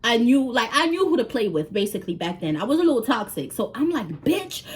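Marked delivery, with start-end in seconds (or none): 4.2-4.6 high, mock angry cussing; cursing him out. Scolding boyfriend over stealing, high mock fury. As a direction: tear into him, voice pitched up. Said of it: "I'm cursing him".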